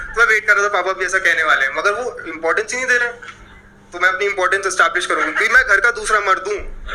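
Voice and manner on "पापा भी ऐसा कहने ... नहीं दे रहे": normal talking voice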